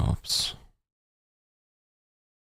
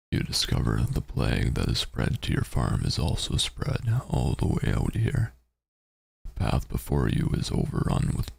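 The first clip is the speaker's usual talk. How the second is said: narrating a text adventure; 3 semitones lower than usual. Acted. Reads text adventure aloud.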